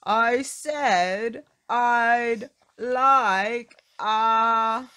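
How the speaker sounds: yelling for bread; top-of-voice shout. Acted. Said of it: "top of his voice".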